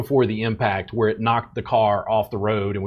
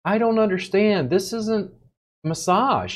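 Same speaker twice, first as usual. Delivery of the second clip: mimicking confused students; 8 semitones higher than usual. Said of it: "What I hear is".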